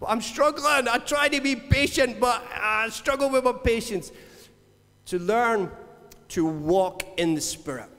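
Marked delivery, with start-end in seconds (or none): 0.1-4.1 higher whiny complaint; hammed-up frustrated believer; mock struggling. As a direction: plead your struggle with a pretend moan. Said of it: "And then you're like".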